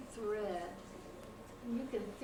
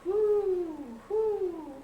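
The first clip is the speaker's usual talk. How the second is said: high, loud owl hoots; two long hoots, high and sliding down; 7 semitones higher, 8 dB louder than usual. A narrator's reading. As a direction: hoot like an owl. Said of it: "asked the owl".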